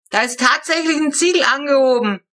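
put-on old man shouting down from the roof; calling down from the roof. A narrator's reading. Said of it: "rief Friedrich hinunter".

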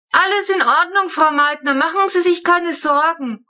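high, loud reassuring call; calling back. A narrator's reading. Papa calling up to the neighbour on her balcony, loud and high. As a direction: shout up cheerfully to the balcony. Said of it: "ruft Papa zurück".

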